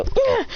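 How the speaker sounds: high effort grunt; short high "ugh" of a blow; punch grunt. Acted. Classmates punching the boy's head, high acted grunt.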